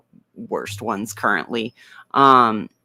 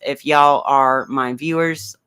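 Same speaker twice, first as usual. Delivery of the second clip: ordinary talk, nothing marked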